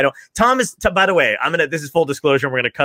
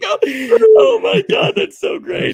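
higher, mocking echo of oh my God; mock gushing echo; mimicking own delight; 5 dB louder than usual. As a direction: parrot the earlier gush back, sarcastically. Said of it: "It's like".

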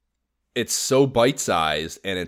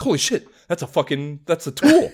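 amazed; 8 semitones higher, 4 dB louder than usual. Retold or imitated. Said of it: "I'm like".

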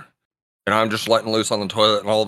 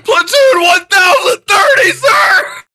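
high, loud shout through laughter; barked military recruit reply; 8 dB louder than usual. Retold or imitated. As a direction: bark it like a terrified recruit. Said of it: "I said".

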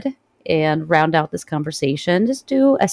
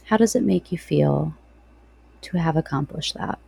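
guided meditation. Real, not acted.

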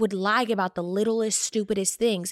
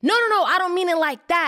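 higher, hurried backpedal; mock protest, pitched up; imitating defensive boyfriend; 7 semitones higher, 4 dB louder than usual. Woman retelling her ex backpedaling to her, imitating his defensive denial. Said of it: "he's like".

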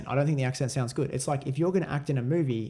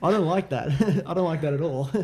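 louder, higher objection through laughter; laughing protest; 4 semitones higher, 3 dB louder than usual. Real, not acted. Guest caught out by co-host over top three artists, laughing protest. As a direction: protest while cracking up.

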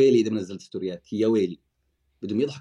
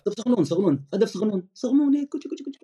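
put-on baby talk; 9 semitones higher than usual. Host teasing about tiny goals, cooing baby talk. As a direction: coo it like talking to a toddler.

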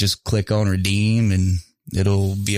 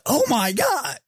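mock excited reaction; slower than usual. Showing the reaction the friend wanted, mock excitement. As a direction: fake an over-the-top gift squeal. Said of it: "I didn't react like".

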